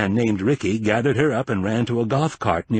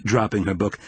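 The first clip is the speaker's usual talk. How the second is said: ordinary delivery, nothing marked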